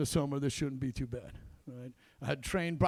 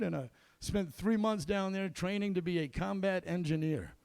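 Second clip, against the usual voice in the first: ordinary talk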